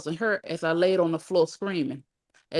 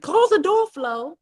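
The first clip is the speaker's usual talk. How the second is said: high-pitched, loud scream; screamed order; 11 semitones higher, 6 dB louder, slower than usual. A narrator's reading. Mom sulking in her room as sister opens door, screaming. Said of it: "my mom screamed".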